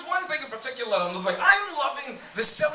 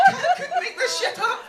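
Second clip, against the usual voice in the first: laughing; 12 semitones higher than usual. Real, not acted. Cracking up at own joke.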